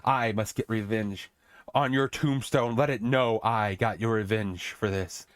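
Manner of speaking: slow, low solemn vow; a slow mock-epic oath of revenge; vengeful king. Grieving king vows revenge at his son's tombstone, slow and solemn. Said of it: "And he goes".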